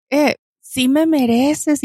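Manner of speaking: high and very slow, stretching the words; a worker calling out to a passing woman; catcalling worker. Retold or imitated. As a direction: drag out a cheeky catcall. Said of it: "me dice".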